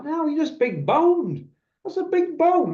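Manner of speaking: high, slow, sugary flatterer; butter him up, drawn out; mock flatterer. Flatterer soothing the fat boxer, high and sugary. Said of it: "flatterer's voice".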